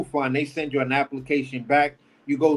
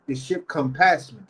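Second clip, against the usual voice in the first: ordinary talk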